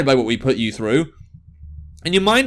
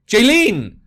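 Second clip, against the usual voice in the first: loud call of the name; 4 semitones higher, 4 dB louder than usual. Acted. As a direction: shout the name after her. Calling for friend being dragged.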